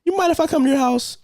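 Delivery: creepy sweet ask. Retold or imitated. Star asking daughter to visit, high sugary voice. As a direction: ask sweetly like a weird friend. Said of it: "she look at the daughter".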